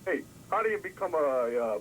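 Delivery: ordinary talk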